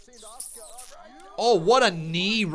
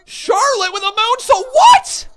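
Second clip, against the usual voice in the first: very high, loud commentator scream; shocked screaming call; 16 dB louder than usual. Real, not acted. Calling a shocking wrestling move.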